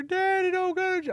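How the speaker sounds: put-on very high, loud child's wail; Tucker. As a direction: imitate a kid crying out for daddy. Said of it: "I can hear Tucker".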